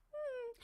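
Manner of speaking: high soft whimpering mm; whimpering hum. Shown as a demonstration. Coach leading viewers in a whimper, soft and high. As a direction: whimper through closed lips. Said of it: "everyone just do a whimper".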